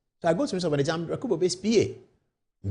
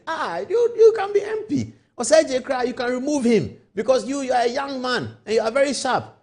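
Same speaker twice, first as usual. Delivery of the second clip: excited mentor; 6 semitones higher than usual. Pretend. Minister voiced in hypothetical story.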